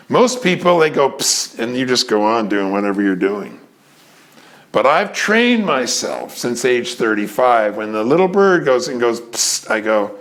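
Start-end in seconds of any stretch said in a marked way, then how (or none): 1.1-1.6 a breathy hiss to get attention; whispered psst. Pretend. A little bird calls to someone, whispered psst. 9.2-9.7 whisper psst. Pretend. Bird whispering to you.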